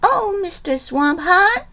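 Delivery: put-on high pleading girl's cry. Liza Lou pleading with the swamp haunt, high mock cry. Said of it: "cried Liza Lou".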